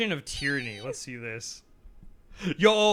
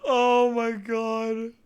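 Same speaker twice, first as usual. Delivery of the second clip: laughing; 6 semitones higher, much slower than usual. Real, not acted. Recovering from laughter.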